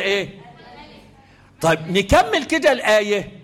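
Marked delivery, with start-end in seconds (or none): none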